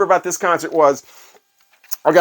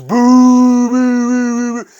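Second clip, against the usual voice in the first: loud, higher-pitched drawn-out "Boo" from a whole arena; imitating a crowd booing; 7 semitones higher, 4 dB louder, much slower than usual. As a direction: boo like a disappointed stadium crowd. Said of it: "Saint Louis goes".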